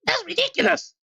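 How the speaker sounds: very high, put-on objecting voice; high-pitched protest. Game character objects to an accusation, in a very high put-on voice.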